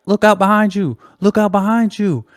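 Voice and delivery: high-pitched imitation of a yelled warning; mock yell. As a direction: call it out high, mimicking a heckler. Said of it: "yelling out".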